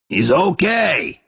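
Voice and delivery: loud approval. Acted. Father's loud verdict.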